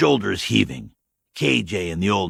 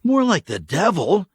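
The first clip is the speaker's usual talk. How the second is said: grim; 11 semitones higher, 5 dB louder than usual. Acted. K J grim reply.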